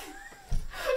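high-pitched burst of giggling; high giggle. Real, not acted. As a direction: break into giggles. Reader laughs mid-sentence.

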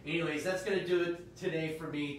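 normal talking voice

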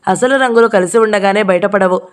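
plain reading voice

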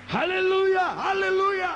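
high, slow shouted hallelujah; exultant praise shout. Real, not acted. Preacher urging the congregation to glorify God, exultant drawn-out shout. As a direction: shout it out high, stretching the final vowels long.

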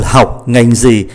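ordinary delivery

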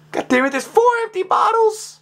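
very high exasperated yell; frustrated shriek. Real, not acted. Player snapping at the puzzle's empty bottles, shrieking. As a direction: let it burst out, at the end of your rope.

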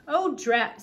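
lightly voiced tiny grumbler, emphatic; tiniest voice. A narrator's reading. Tiny king cursing in the dark, a tiniest voice. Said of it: "said the tiniest voice in the world".

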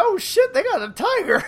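high, giddy squeal about a tiger; hammed-up glee; excited Negan. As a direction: imitate Negan thrilled. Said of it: "he's like".

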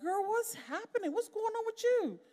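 high-pitched mock exasperation; girl, what is wrong with you, pitched high; talking to herself. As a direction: raise the voice way up, teasing yourself. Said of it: "I'm just like".

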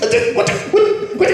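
very high, stammering imitation of losing it; mock breakdown. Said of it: "He, like, broke down".

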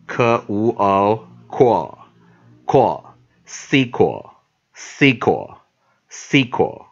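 科无o sounded out, blended into core, then sequel; pronunciation demo. Shown as a demonstration. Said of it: "现在这样念哈". (大声慢速拼读 core、sequel)

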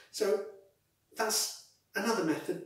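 muffled behind fabric; speaking through neck gaiter; through a cloth mask. Real, not acted. Seen 2: Purple buff covers his nose and mouth throughout; removed by 6.7.

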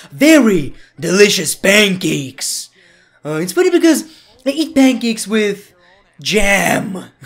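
loud, hammed-up wrestler voice; Triple H impression. Wrestler talking to Stephanie about pancakes, hammed-up impression. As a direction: punch each food word with mock gravity. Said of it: "I can just do a Triple".

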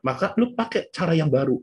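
ordinary talk

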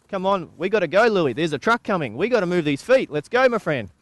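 loud, high urging to a stalled horse; urgent pleading. Acted. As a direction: hurry a stuck horse out of danger. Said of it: "you know, I'm saying".

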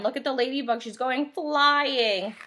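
usual speaking voice

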